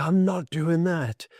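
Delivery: indignant refusal. Retold or imitated. Patient recalling his reaction to little annoyances, indignant.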